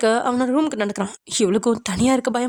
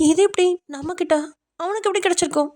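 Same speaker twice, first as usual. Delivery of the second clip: high-pitched, wondering; puzzled thought; 8 semitones higher than usual. A narrator's reading. Woman wonders how he got the pad, high and puzzled. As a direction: muse to yourself in baffled surprise. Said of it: "அப்படின்னு யோசிக்கிறா".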